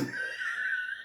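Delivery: very high, breathy laughing squeal; shrieking laugh. Real, not acted. Reacting to the judge dismissing the witness, high squealing laugh.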